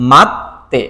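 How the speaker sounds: মাত্তে split as mat-te with a held 't'; doubled consonant. Shown as a demonstration. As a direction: hold the 't' in মাত্তে before releasing 'te'. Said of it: "দুইবার উচ্চারিত হবে".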